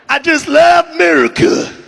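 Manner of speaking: loud patriotic shout; loud proclamation. Real, not acted. MAGA guy shouts his answer.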